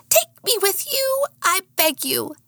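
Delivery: very high pleading pet voice; begging dog voice. Acted.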